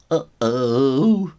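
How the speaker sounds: mock scared; nervous uh-oh. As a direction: let the bully's nerve crack. Bully realises trouble.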